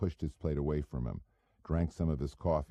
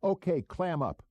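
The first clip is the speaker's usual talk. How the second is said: higher, mocking sneer; 13 semitones higher than usual. A narrator's reading. Goebel taunting the silent narrator with a higher sneer. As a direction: sneer the words at him. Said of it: "Goebel sneered".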